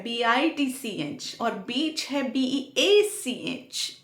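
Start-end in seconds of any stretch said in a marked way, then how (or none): none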